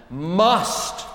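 'must' lifted and held out; stressed word. Shown as a demonstration. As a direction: lean on 'must'. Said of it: "the strong vocabulary of".

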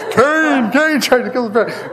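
high, loud mock yell, laughing through it; dad yelling. Dad coming down the stairs, laughing mock yell. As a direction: play the outraged father while cracking up. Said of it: "he comes trying down".

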